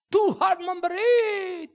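high, loud, slow proclamation; soaring outcry; fervent cry. Acted. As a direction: cry it out to heaven. Job's cry, repeated line.

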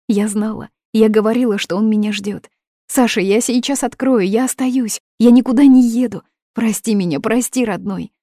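high, loud rush of joy; excited relief. Acted. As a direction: burst out happy and breathless. Overjoyed, thinks Sasha came.

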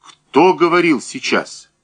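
high-pitched demanding question; crying out. A narrator's reading. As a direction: call out, demanding an answer. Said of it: "крикнул Данилов". (требовательный окрик)

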